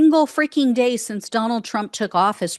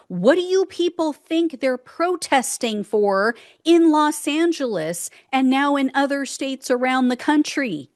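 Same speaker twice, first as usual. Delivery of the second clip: usual speaking voice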